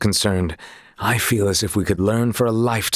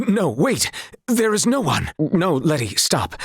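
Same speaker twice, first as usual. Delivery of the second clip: much higher, hurried protest; panicked, much higher no wait; flustered denial; 6 semitones higher than usual. Acted. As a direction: rush to deny it, flustered.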